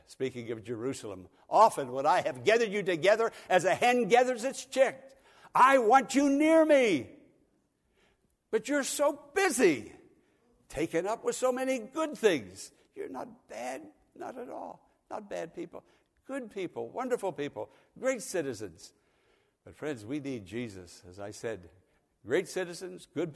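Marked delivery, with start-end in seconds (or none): 1.5-12.7 high, loud, yearning plea; Jesus pleading. Acted. Jesus calling Jerusalem to him, high and pleading. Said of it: "Jesus said". 12.9-18.9 gentle reassurance. Real, not acted. Gentle reassurance after Jesus quote.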